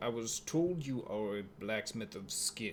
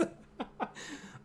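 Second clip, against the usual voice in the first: quiet unvoiced chuckle; soft breathy laugh; 6 dB quieter than usual. Real, not acted. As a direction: let a short laugh slip. Player laughs.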